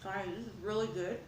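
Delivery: talking with a mouth full of food. Real, not acted. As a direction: speak through a mouthful while eating. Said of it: "I usually don't speak with my mouth full".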